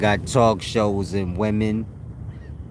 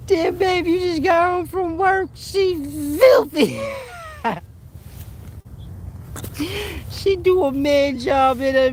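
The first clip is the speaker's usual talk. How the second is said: laughing. Retold or imitated. Same quote, harder laughing.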